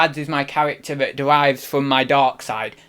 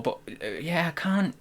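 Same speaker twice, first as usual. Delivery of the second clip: normal talking voice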